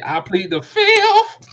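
very high, loud, slow dragged-out impression; hammed-up high-pitched I plead the fifth; mock plea. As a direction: drawl it like a coy witness. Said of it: "[name] about to say".

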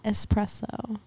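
"Espresso" softly, with the opening "es" sounded; copied pronunciation. Shown as a demonstration. Friend copying the corrected espresso, careful full pronunciation. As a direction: repeat "Espresso" quietly with its full first syllable. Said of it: "How do you say it?".

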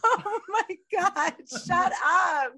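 high, loud, laughing protest; scandalised and cracking up; laughing shriek. Real, not acted. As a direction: shout it through real laughter. Laughing outburst at co-host's crude joke.

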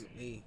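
"Lem E" said softly, E apart from LEM; soft, separated LEM and E; E, not T. Shown as a demonstration. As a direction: quietly repeat "Lem E" with no T. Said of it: "it's E, not T".

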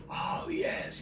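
hushed, breath-only crowd roar; imitated crowd response. Audience answers a song title, imitated as a whispered roar. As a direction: whisper it like a distant cheering audience.

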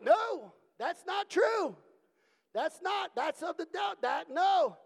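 high, loud cry of no; shouting protest. Retold or imitated. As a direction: yell it out in protest. Protesting inside haunted show.

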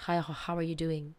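usual speaking voice

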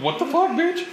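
high-pitched mock indignation; retold high squawk of disbelief; indignant disbelief. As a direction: protest it high, without shouting. Retells reaction to being rejected.

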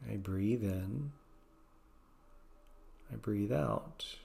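slow soft repeated mantra. Shown as a demonstration. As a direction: let the mantra sink to a hush. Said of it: "Maybe just gently saying".

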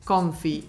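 "comfy" as Australians say it; casual English "comfy"; Aussie slang. Shown as a demonstration. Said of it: "en vez de decir confortable".

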